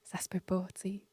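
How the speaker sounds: murmure sans voix, stupéfaite; chuchotement incrédule. Retold or imitated. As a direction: souffle son étonnement. Pensée émerveillée chuchotée. (murmure incrédule)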